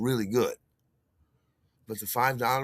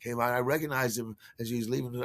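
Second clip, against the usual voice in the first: ordinary talk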